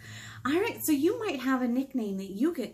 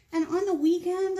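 ordinary delivery

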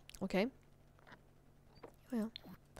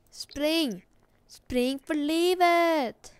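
mock panic; 6 semitones higher, 7 dB louder, much slower than usual. Urging character to run again.

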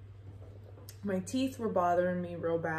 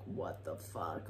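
very low, slow mutter of disbelief; 10 dB quieter, slower than usual. Retold or imitated. Driver reacts to being asked out, low stunned mutter. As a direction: drag it out under your breath, way down low.

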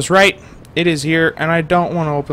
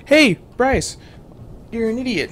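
high, taunting viewer voice; the audience calling him an idiot; mocking viewers; 8 semitones higher than usual. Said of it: "You guys are probably like".

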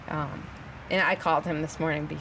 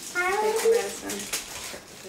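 high, slow sing-song coaxing; coaxing singsong; much slower than usual. Real, not acted. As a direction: draw it out sweetly to coax. Mother coaxing child again.

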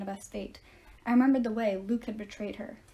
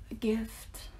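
breathy whispered mutter, under the breath; hushed whispered aside; 5 dB quieter than usual. A narrator's reading. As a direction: whisper it to yourself. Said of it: "Grover muttered".

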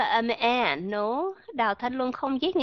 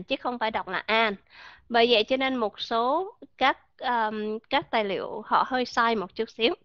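normal talking voice

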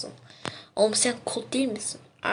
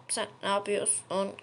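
normal talking voice

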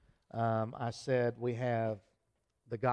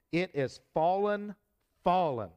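rising loud cry of fallen, fallen; emphatic proclamation; 5 semitones higher, 4 dB louder than usual. Acted. Preacher reading doom to the congregation, emphatic loud proclamation. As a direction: proclaim the fall with force.

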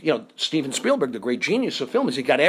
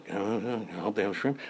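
low, slow barely audible mumble; movie-actor underplaying; 8 semitones lower, 9 dB quieter, slower than usual. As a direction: toss the line away very softly. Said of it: "he's a movie actor".

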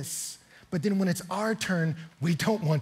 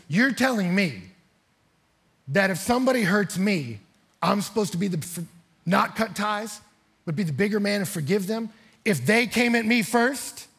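raised, louder indignant protest; incredulous, building indignation; offended; 4 semitones higher, 5 dB louder than usual. Retold or imitated. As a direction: act out an offended young man arguing back. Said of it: "And then he gets offended".

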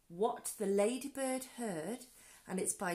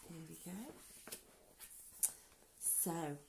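plain reading voice

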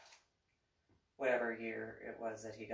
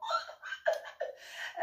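real laughter. Real, not acted. Laughing at the memory.

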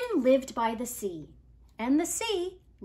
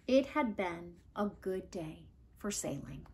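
soft closing line; 5 semitones lower, 6 dB quieter than usual. A narrator's reading.

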